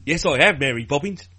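hammed-up Cockney from Mary Poppins; bouncy put-on Cockney; mock English accent. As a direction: overdo a bad English accent. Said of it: "You haven't heard their English accents".

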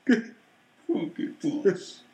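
very slow, muffled silly voice; voice into a can. Acted. Redoing the joke for the co-host, into a can. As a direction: stretch it out as if talking into a can. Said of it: "I need to say that into the can".

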